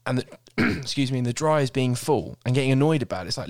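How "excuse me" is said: usual speaking voice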